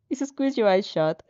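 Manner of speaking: ordinary delivery, nothing marked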